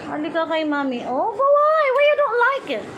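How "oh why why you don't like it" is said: much higher, louder coaxing; pleading pet talk. Real, not acted. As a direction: beg the bird, voice up high. Pleading with her pet bird.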